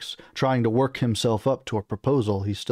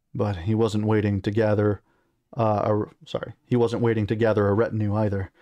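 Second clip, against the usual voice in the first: ordinary delivery, nothing marked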